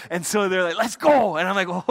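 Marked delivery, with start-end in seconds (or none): 0.8-1.4 loud, high excited shout; rally the group like a keen guide; eager call. Retold or imitated. Preacher voicing safari drivers setting off, eager call.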